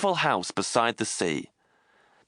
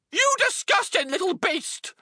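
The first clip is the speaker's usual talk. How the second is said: high, sneering aunt voice; nasty aunt. A narrator's reading. Cruel aunt sneering at the boy James. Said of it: "always referred to him as".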